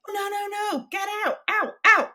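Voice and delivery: high-pitched imitation of frantic shooing; mock panic. Host barring the speaker from her house, mock panic. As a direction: shriek it like a comically alarmed host, ending loud.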